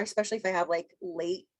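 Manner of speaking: ordinary talk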